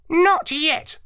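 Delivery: high squawky parrot, explaining; lecturing like a clever parrot. Acted. Parrot lectures the dog narrator, high and squawky.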